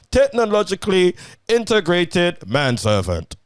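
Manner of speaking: high, loud robotic monotone. Pretend. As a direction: end the read like a robot. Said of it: "Gotta read it like a robot".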